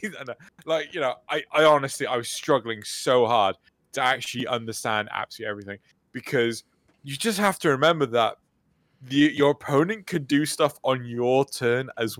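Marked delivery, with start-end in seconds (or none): none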